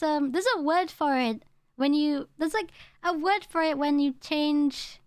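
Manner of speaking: usual speaking voice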